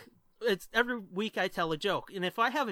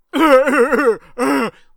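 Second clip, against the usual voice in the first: loud, raucous goofy laugh; 4 semitones higher, 16 dB louder than usual. Shown as a demonstration. Co-host showing how he cracks up at the reaction, loud goofy laugh. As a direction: burst out in a big, silly laugh. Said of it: "laugh like that".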